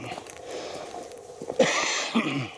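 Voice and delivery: rough unvoiced cough. Real, not acted. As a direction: cough hard. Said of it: "vous pouvez m'entendre tousser".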